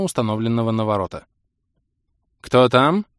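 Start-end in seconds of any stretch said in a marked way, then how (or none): 2.4-3.1 lordly, casual query, raised in volume; nonchalant. A narrator's reading. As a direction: answer the intercom with lazy self-assurance. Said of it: "вальяжно спросила Лира". (вальяжно, громче обычного)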